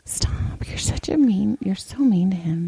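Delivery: hushed teasing whisper. Real, not acted. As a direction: whisper it so he can't hear. Teasing about being mean.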